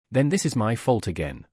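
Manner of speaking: ordinary delivery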